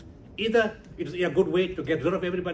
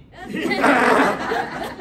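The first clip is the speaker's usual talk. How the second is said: laugh; 8 semitones higher, 9 dB louder than usual. Real, not acted. Laughs at the story.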